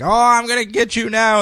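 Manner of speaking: gloating villain. Retold or imitated. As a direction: relish catching her. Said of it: "he's like".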